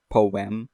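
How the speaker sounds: "Poem" said as two syllables, poh-EM; "Poem" with both vowels sounded separately. Shown as a demonstration. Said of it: "The two syllables".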